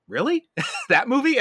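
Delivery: high, disbelieving with a laugh; mock disbelief, half laughing; incredulous. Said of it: "I'm like".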